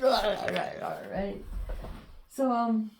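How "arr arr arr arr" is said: soft mock growls fading to unvoiced breath; imitating dog growls. The dog growling back when she hugs it. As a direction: growl like the dog, then let it die away. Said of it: "she'd be like".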